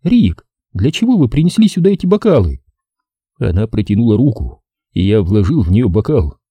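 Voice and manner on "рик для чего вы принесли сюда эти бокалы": put-on girl voice. A narrator's reading. Katie voiced high.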